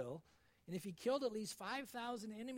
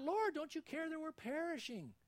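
panicked disciples; 8 semitones higher, 3 dB louder than usual. Acted.

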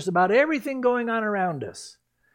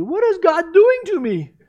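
high, loud dismayed question; startled, fearful outburst; alarmed cry; 12 semitones higher, 7 dB louder than usual. Retold or imitated. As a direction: wail it like a guilty man. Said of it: "my first response would not be".

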